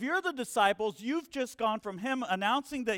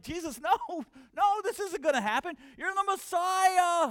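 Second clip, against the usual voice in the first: pleading protest; 7 semitones higher, 3 dB louder than usual. Retold or imitated. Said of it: "And Peter was like".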